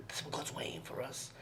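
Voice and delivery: low, hushed whisper of comfort; soft whisper. Retold or imitated. Friend comforts a dying girl in a soft whisper.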